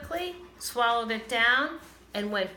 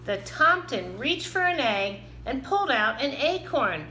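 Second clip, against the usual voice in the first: usual speaking voice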